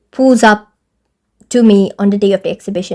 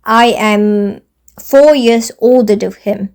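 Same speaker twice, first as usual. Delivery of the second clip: slow indignant reveal; slower than usual. Real, not acted. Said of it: "indignant reveal".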